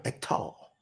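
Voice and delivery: "act tall" almost whispered, with the T carried onto "tall"; very soft country "act tall", hard T on the second word; country pronunciation. Retold or imitated. Preacher repeating granddaddy's word after spelling it, country pronunciation. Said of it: "That's that country tongue".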